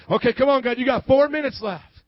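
loud, pushy countdown at God; mock exasperated demand. Believer giving God a deadline, loud mock impatience. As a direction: bang on heaven's door, impatient.

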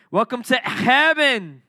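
slow loud welcome. Shown as a demonstration. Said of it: "Did I say it right?".

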